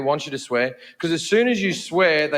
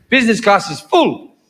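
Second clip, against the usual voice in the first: mimicking clerk; 7 dB louder than usual. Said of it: "She goes".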